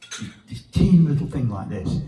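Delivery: normal talking voice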